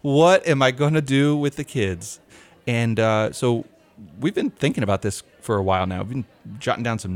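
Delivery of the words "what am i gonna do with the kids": mock parent panic. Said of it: "they're thinking".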